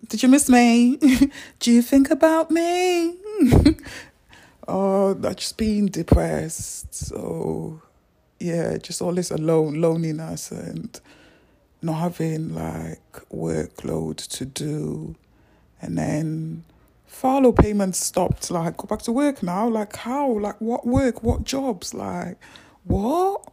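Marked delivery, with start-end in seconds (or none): 0.1-3.8 high, loud teasing sing-song; playful teasing. Real, not acted. Vlogger teases viewers about missing him, high and loud. 17.1-23.5 rising, loud how-am-I-supposed-to disbelief; exasperated rant. Real, not acted. Vlogger rants about furlough ending, loud exasperated disbelief.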